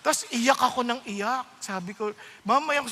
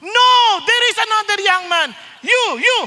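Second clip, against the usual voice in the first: preacher calling out; 7 semitones higher, 10 dB louder than usual. Retold or imitated. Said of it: "Bigla pa namang".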